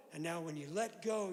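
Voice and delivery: ordinary talk, nothing marked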